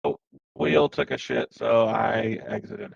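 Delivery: glitchy, robotic mic distortion. Real, not acted. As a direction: voice through a broken mic. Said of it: "You sound like a robot right now".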